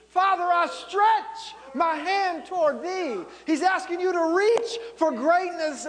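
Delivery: high, loud, impassioned sermon climax; full-force preaching. Real, not acted. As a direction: drive it home to the congregation at full voice.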